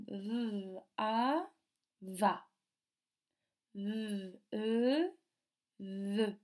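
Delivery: long held "V", long vowel, then the blended "va"; sounding out syllables. Shown as a demonstration. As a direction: stretch "V" and each vowel apart, then blend them. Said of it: "on va lire des syllabes".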